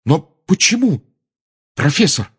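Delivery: high, loud protest; outraged. A narrator's reading. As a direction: object hotly to an unfair penalty. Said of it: "Гарри был взбешён этой несправедливостью". (возмущённый, громкий протест)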